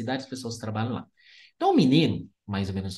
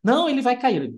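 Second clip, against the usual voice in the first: worried mother; 9 semitones higher, 4 dB louder than usual. Retold or imitated. Mother objects in retold quarrel.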